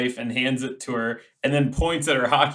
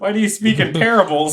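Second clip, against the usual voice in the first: teasing; 5 semitones higher, 5 dB louder, faster than usual. Acted. Player mocking NPC speech.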